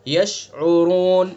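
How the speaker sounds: यशउरून with the ऊ held two alifs; two-alif stretch. Shown as a demonstration. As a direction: hold the ऊ in यशउरून for two counts. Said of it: "दो अलिफ". (यशउरून दो अलिफ खींचकर)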